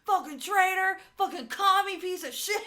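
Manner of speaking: high, loud mock rage; raging shout. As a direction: scream insults at the screen. Mock patriotic rant continues.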